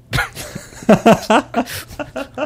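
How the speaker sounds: shrieking laugh, high and sudden; high startled laugh; high burst of laughter. Retold or imitated. Reaction in retold story.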